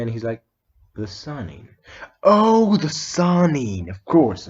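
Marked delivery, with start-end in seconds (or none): 1.0-1.7 quiet awed murmur. Retold or imitated. Naruto repeats the title, awed. 2.2-3.9 high, loud burst of recognition, the Sannin stretched slowly; sudden realization. Retold or imitated. Naruto realizing who the stranger is, loud surprised cry. 3.9-4.5 eager agreement. Retold or imitated.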